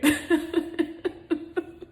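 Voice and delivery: high, pulsing bursts of sobs; real sobbing. Real, not acted. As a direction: sob in quick high bursts. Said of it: "But what, cry?".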